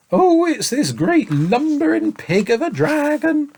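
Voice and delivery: put-on high, slow sobbing child; sobbing boy. A narrator's reading. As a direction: sob out the complaint. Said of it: "sobbed the boy".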